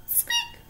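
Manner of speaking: very high, soft critter squeak; tiny squeak. Acted. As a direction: squeak like a mouse.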